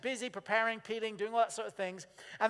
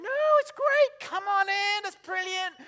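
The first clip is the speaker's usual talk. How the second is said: much higher, louder fake-bright welcome; over-the-top fake enthusiasm, slowly; forced cheerful host; 11 semitones higher, 9 dB louder than usual. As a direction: gush like a host hiding dread. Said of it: "How many of you are going".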